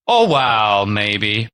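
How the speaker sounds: normal talking voice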